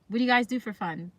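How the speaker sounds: ordinary delivery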